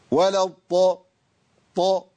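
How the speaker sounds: ض in الضاء struck hard as an emphatic T; forced Dad. Shown as a demonstration. Teacher warns against attacking the letter, forced ض in الضاء turning to ط. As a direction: push الضاء until its ض becomes ط. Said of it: "لو دخلت على الضاد بقوة هجيب طاء".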